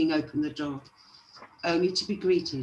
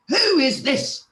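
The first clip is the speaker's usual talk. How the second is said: loud, high, indignant demand; angry dwarf's raised-voice challenge; rude shout; 10 semitones higher, 8 dB louder than usual. A narrator's reading. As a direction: shout it crossly at an intruder. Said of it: "he shouted rudely".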